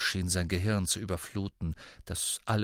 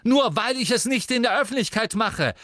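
high, loud protest; angry defence; 12 semitones higher, 7 dB louder than usual. A narrator's reading. Brother defends himself to his taunting sister, angry. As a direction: shout back defensively. Brother shouts back in quarrel.

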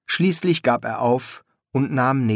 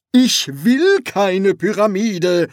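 high, loud, slow protest; crying out; 9 semitones higher, 7 dB louder, slower than usual. Acted. Dead pharaoh refusing his son's pyramid, crying out. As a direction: shout each word out in protest. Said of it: "rief der Pharao".

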